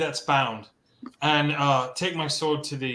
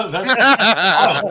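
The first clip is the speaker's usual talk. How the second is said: loud, high laughing through words; real stunned laughter; 7 semitones higher, 9 dB louder than usual. Real, not acted. Player floored by the demonic twist, laughing hard. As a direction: crack up in disbelief.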